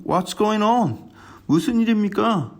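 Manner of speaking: slow, clearly spoken "What's going on?"; English phrase demo. Shown as a demonstration. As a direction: stretch out "What's going on?" slowly like an English lesson. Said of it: "오늘의 생활 영어예요". (영어 표현 "What's going on?"을 천천히 또박또박)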